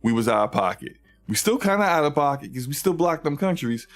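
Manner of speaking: high, eager, sugary pitch-man voice; a corporate rep sucking up to PC gamers; fawning Sony rep. Retold or imitated. Sony courting PC gamers, pitched high and fawning. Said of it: "they respect P C gamers".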